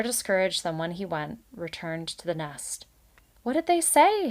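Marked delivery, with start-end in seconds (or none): 3.4-4.3 put-on high eager child question; anxious kids asking dad; children asking. A narrator's reading. Children ask returning Valerian, high anxious. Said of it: "his children asked".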